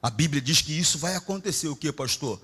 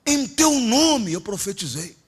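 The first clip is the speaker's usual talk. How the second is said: slow, high pleading; a believer pleading before the Lord; 10 semitones higher, 6 dB louder, slower than usual. Retold or imitated. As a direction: plead your works to the Lord. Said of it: "Aí ele vai falar assim".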